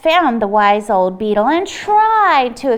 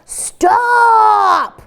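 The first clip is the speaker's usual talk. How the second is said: loud, high commanding stop; shout; 7 semitones higher, 4 dB louder than usual. Acted. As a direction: bark it to end the fight. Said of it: "the chief frog shouted out".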